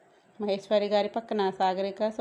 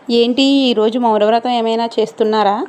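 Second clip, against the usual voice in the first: loud teasing; 2 semitones higher, 14 dB louder than usual. Acted.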